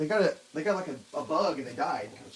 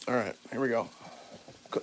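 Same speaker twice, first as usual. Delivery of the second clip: ordinary talk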